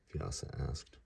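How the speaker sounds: usual speaking voice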